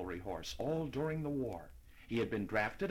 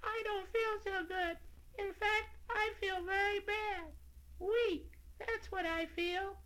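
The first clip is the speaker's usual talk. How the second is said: weak tiny voice; 3 dB quieter than usual. A narrator's reading. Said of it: "he whispered".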